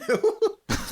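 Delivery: a squeal of laughter up high; real delighted laugh; high burst of laughter. Real, not acted. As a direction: crack up at the joke. Cracking up at own story.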